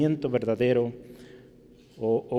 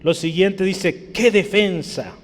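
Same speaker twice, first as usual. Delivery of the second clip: higher and louder, slowed on each "qué"; preaching at full force; 5 semitones higher, 6 dB louder than usual. Acted. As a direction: proclaim it to the congregation. Predica leyendo el versículo. (predicación a plena voz, lenta y fuerte)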